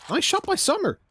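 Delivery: sudden high-pitched shout of praise; excited call. Real, not acted. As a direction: spike up in surprise at the play. Caster surprised by a shot.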